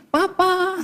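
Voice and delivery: softly cooed, drawn-out call; submissive wife. Acted. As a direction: imitate a devoted wife calling her husband softly. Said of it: "istrimu sudah begini". (menirukan istri memanggil dengan lembut)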